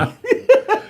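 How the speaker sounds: loud, high-pitched laugh; burst of laughter. Real, not acted. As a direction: explode into a real laugh. Laughs at rod question.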